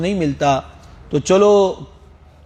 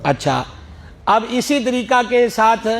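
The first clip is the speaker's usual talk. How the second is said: normal talking voice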